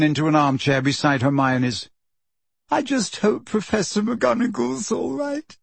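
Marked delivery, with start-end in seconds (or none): 2.7-5.6 higher, tearful worry. A narrator's reading. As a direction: near tears, voice wobbling. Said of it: "said Lavender tearfully".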